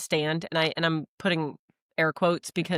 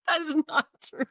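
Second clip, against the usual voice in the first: high, amused denial through laughter; laughing protest; 9 semitones higher than usual. Real, not acted. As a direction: protest while giggling. Laughing denial of partner's joke.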